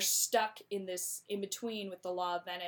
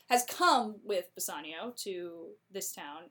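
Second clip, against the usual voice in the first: ordinary delivery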